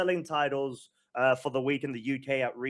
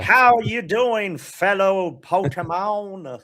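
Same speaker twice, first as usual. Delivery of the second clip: slow, higher, louder hammed-up Texan drawl; bad Texas accent; 5 semitones higher, 6 dB louder, slower than usual. Host greeting fellow Pokemon in a bad Texas accent. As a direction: lay on a fake Texas accent, slow and loud. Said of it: "which is totally not a Texas accent".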